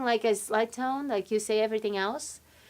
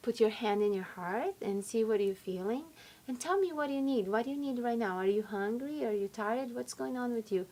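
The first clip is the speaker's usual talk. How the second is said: put-on soft, soothing questions to a child; tender mother; 6 dB quieter than usual. Mother calming her tired six-year-old, soft and tender. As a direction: gently coax out what is wrong. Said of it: "I tell my little six-year-old".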